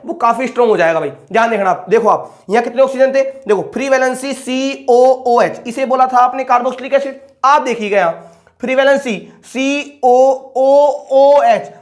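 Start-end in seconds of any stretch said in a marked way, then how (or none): 3.2-5.6 slow dictation. Shown as a demonstration. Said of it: "teacher slowly spelling out formula". 7.4-11.7 slow dictation. Shown as a demonstration. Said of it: "teacher slowly spelling out formula".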